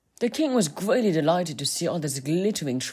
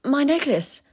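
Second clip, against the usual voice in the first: maiden voice; 11 semitones higher, 4 dB louder than usual. Acted. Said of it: "replied the maiden".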